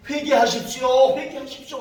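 crying out. Acted. John the Baptist calling people to repent, crying out. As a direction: proclaim it loudly, then ease off. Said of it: "외쳤던 것처럼".

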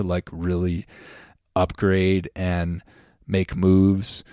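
ordinary talk, nothing marked

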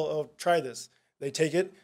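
ordinary talk, nothing marked

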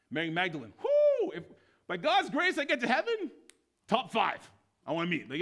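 high, loud exclaiming; bubbling over with enthusiasm; excited preaching. Real, not acted. Preacher exclaiming about Mary Magdalene, high and loud.

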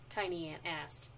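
usual speaking voice

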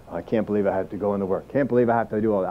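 quick, low mock whine about going to work; mock grumbling. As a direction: mutter it like someone dreading work. Imitated reluctant worker.

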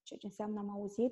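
usual speaking voice

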